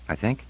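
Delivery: "I think" rising up at the end as a question; rising inflection. Acted. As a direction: lift "think" like asking. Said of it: "The rising inflection".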